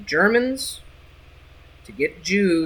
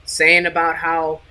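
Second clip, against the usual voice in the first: usual speaking voice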